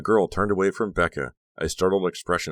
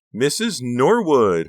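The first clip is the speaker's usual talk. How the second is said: high, loud, stretched-out greeting; excited shriek; 9 semitones higher, 9 dB louder, much slower than usual. A narrator's reading. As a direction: shriek the name with delight. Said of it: "Honey shrieked excitedly".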